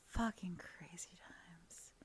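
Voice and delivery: slow, soft whisper; hushed musing. Real, not acted. Musing on the crazy situation, whispering. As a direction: whisper it slowly to yourself.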